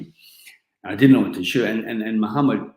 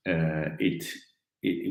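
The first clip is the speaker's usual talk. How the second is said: ordinary talk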